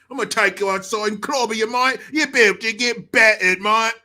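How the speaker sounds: loud, hammed-up British lad; mock British threat. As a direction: threaten loudly in fake British. Acted British threat with suggested slang.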